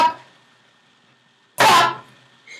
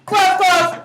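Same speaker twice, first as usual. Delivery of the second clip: normal talking voice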